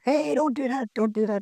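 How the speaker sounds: high-pitched mock yell of alarm; half-hearted replay of a panicked shout; retold scream. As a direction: call out the warning like a frightened dad. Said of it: "He's screaming around there".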